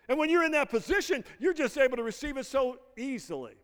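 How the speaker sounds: rising pulpit fervour; full-force preaching. Acted. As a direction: preach it out to the congregation.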